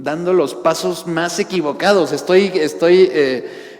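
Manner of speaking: loud, fervent sermon delivery; preaching. Real, not acted. Pastor preaching on repentance at full force. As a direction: drive the message home to the congregation.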